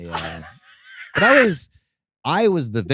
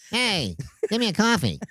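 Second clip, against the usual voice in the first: mock impression; 10 semitones higher than usual. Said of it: "I talk like a legal dwarf".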